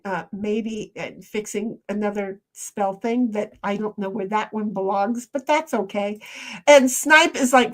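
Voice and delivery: ordinary talk, nothing marked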